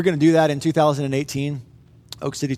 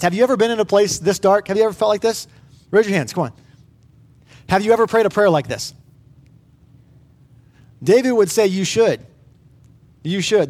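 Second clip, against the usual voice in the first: higher and louder, urgent preaching appeal; 4 semitones higher, 4 dB louder than usual. Real, not acted. Pastor urging the congregation to pray raw prayers, fervent. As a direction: press the congregation to pray honestly.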